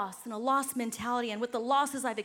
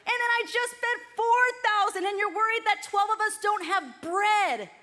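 higher, slowed, weary disbelief at the disciples; exasperated Jesus; 7 semitones higher, 3 dB louder than usual. Retold or imitated. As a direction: sigh it out like a fed-up teacher.

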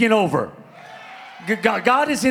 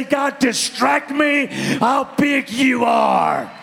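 rising, shouted slow plea; fervent preaching; 3 semitones higher, slower than usual. Real, not acted. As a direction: build the prayer into a shout. Preaching climax, calls congregation.

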